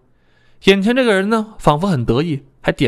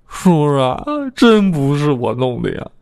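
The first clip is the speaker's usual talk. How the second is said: whiny near-tears plea; slower than usual. A narrator's reading. Suspect pleads his innocence to the deputy chief, near tears. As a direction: beg him with a tearful whine. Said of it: "我一脸哭相辩解掉".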